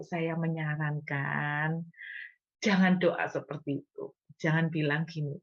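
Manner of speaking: normal talking voice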